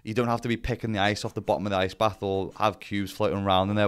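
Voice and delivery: ordinary talk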